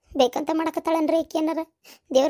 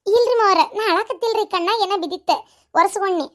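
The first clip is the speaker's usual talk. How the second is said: higher, louder, caught out; flustered denial; 5 semitones higher, 4 dB louder than usual. Acted. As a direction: hastily cover up the tears. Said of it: "ಒಲಿ ಮುಂದ್ ಕುಂತ್ ಅಳುದ". (ಗಾಬರಿಯಿಂದ ಅಳು ಮುಚ್ಚಿಡುವ ಮಾತು)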